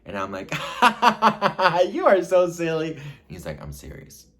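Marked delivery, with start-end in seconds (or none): 0.5-1.9 loud, high staccato cackle; exaggerated high ha-ha-ha laughter; mock laugh. Acting out laughing him off. 1.9-3.0 high, loud mock amusement; loud, giddy, pitched-up teasing; laughing it off. Laughing off his proposal. 3.6-4.3 low, hushed reply; imitating a grave, quiet man; mock seriousness. Suitor's grave reply after laughter.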